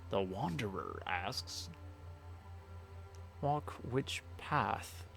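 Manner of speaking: very slow, hushed, portentous delivery; grand oracle. Acted. Streamer reads the Wanderer's question from the game, slow mystic oracle. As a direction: intone it slowly like a mystic.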